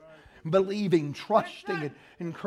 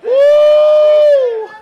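high, loud preacher's "woo"; exultant whoop; 17 dB louder than usual. Real, not acted. Preacher to his congregation mid-story, an exultant high whoop. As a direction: let out a sudden, joyful holler.

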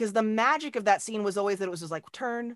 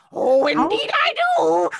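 higher, louder gleeful witch; witch reply; 9 semitones higher, 9 dB louder than usual. Retold or imitated. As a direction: purr it back like a hungry old witch. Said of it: "and he's like".

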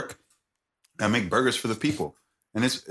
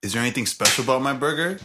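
normal talking voice

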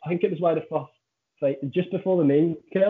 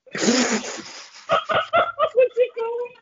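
high-pitched, helpless laughing question; much slower than usual. Real, not acted. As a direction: squeeze the question out through laughter. Laughing at own fumbled words.

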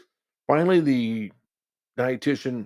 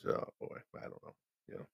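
muttering; 16 dB quieter than usual. Retold or imitated. Narrator mutters to himself.

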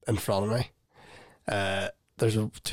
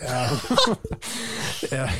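usual speaking voice

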